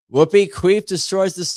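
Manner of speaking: high, loud and gleeful; excited shout. Real, not acted. Streamer cheering a winning answer, high excited shout. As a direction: belt the answer out in delight.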